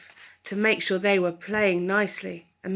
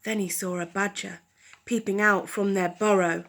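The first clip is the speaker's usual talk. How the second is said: usual speaking voice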